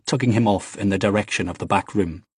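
plain reading voice

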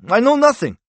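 very high, loud protest; cornered man's outburst; vehement denial. A narrator's reading. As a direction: deny it loudly with pitch shooting up. Cornered man denies everything.

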